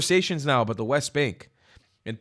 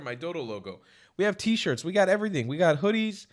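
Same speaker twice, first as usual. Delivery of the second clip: normal talking voice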